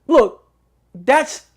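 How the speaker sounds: ordinary talk, nothing marked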